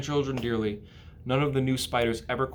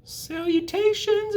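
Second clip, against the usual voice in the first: very high, slow tiny voice; tiny spider greeting the pig; small spider voice; 4 dB louder, slower than usual. Acted. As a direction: squeak it like a baby spider. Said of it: "heard a small voice".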